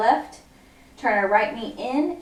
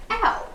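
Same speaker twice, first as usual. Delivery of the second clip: squeaky pop star "ow" much higher; high "ow" yelp; 12 semitones higher than usual. Shown as a demonstration. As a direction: yelp it like the singer. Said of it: "So it's gonna go".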